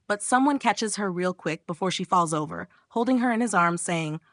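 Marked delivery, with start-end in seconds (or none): none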